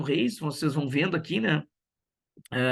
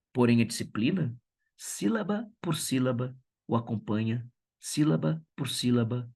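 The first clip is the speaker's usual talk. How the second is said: a teacher reciting a poem about making poems; poem reading; 4 semitones lower than usual. Acted. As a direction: savor "sílaba por sílaba" and let the last line fade. Teacher reads poem aloud.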